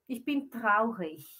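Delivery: ordinary talk, nothing marked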